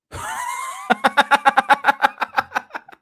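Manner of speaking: long, high, loud cackle of laughter; loud laughing fit. Real, not acted. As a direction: crack up helplessly. Cracks up at own accent.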